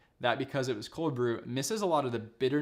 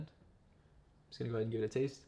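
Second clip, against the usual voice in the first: slurping coffee while talking; 5 dB quieter than usual. Real, not acted. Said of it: "Don't mind my slurping".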